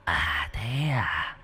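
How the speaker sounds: very slow, breathy ghost whisper; ghostly whisper. A narrator's reading. Ghost answering Vĩnh, slow eerie whisper. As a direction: draw it out like a spirit hissing.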